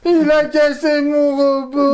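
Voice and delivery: high, slow, loud mock whine; whining childish wail. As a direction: wail it like a sulking kid. Mock wailing over lost robot.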